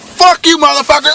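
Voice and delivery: high, loud yell of fury; chase yell. Retold or imitated. Chasing the robbers in his cab, high loud yell. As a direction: scream it out the cab window.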